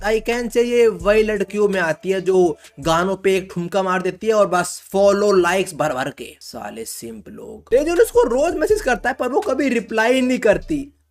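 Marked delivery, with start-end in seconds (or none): 6.4-7.8 muttered jab. Real, not acted. Mocking aside about simps.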